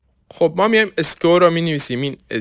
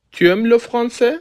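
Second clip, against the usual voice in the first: "Tu aimes le français" slow, on a high pitch rising at the end; rising question intonation; 9 semitones higher, slower than usual. Shown as a demonstration. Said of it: "با تغییر تون صدامون".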